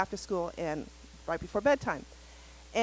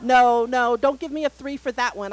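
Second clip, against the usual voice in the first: loud, higher, half-mock child protest; imitating son's loud objection; kid protesting; 5 semitones higher, 14 dB louder than usual. Son protesting his score.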